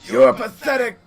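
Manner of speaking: loud, high-pitched scornful yell; yelled taunt. Acted. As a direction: shout the insult at him at full voice. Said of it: "yell out to him".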